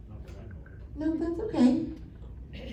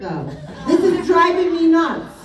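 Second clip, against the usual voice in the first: ordinary talk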